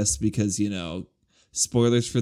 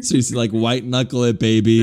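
laughing, louder joking tease; 6 dB louder than usual. Real, not acted.

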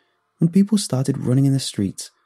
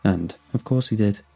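ordinary delivery

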